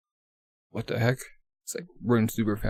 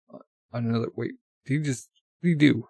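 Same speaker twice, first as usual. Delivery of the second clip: usual speaking voice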